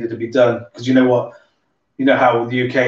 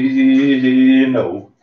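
loud, drawn-out stammer on "you"; hammed-up stuck-word impression; guy from Vicar of Dibley; 5 dB louder, slower than usual. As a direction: stutter it like a sitcom villager. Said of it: "that guy from Vicar of Dibley".

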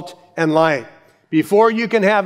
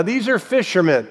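normal talking voice

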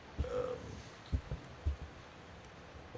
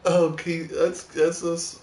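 startled excitement; 8 semitones higher, 11 dB louder, slower than usual. Real, not acted. Startled by creepy game moment.

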